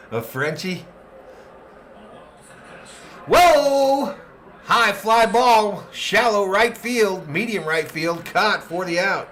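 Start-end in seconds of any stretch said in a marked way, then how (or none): 3.3-4.1 sudden high, loud startled whoa; startled shout at the crack of the bat; surprised whoa. Real, not acted. Sudden high fly ball. 4.6-9.3 rising thrill as the ball goes up; excited announcer tracking a fly ball; excited call. Real, not acted.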